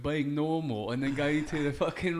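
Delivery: lightly done cockney bloke, mate-style; put-on British accent. Guest on the show, answering in a light mock British accent. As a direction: play a chatty Brit. S0 plays British character Welvin.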